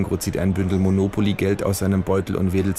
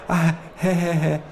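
nervous laugh; 11 semitones higher than usual. Acted. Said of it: "mit Fistelstimme".

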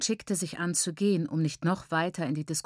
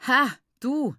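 lauter, hoher Spottausruf; verächtlich herausplatzen; höhnisch; 6 semitones higher, 5 dB louder than usual. A narrator's reading. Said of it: "höhnte meine Mutter". (höhnischer Ausruf)

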